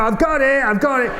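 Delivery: high excited mock teen crowing; teen boys. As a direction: lads thrilled to catch it on phone. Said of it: "And they go".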